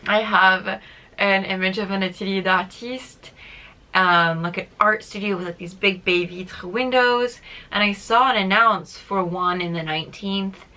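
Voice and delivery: ordinary delivery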